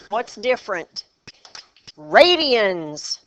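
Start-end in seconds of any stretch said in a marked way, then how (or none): 2.0-3.2 emphatic. Real, not acted. Teacher spots the calculator error.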